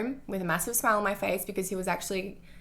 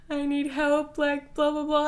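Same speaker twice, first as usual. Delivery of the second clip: high, pitched-up mock-desperate cry for help; dramatic plea; 8 semitones higher, 4 dB louder, slower than usual. Retelling frantic texts to her ex John, mock dramatic. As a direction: play up your own melodrama, half laughing at it. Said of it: "I was being so dramatic".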